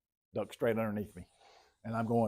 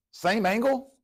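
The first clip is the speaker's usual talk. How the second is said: much higher, louder, astonished question; mock surprise; 11 semitones higher, 7 dB louder, slower than usual. Teacher to class on a repeat angle, mock astonished. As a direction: sound amazed it's the same angle.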